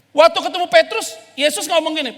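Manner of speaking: loud, high preaching at full force; fiery preaching. Acted. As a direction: preach it hard to the congregation.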